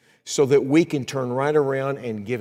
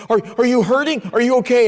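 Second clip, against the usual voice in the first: much higher and louder, anxious questioning; wife anxiously checking on her weeping husband, much higher; worried wife; 13 semitones higher, 8 dB louder, faster than usual. Retold or imitated. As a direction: check on him in alarm. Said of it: "she said".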